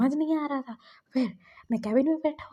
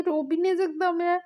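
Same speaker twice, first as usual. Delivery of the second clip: tearful retort; 6 semitones higher than usual. Retold or imitated. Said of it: "वो रहा था".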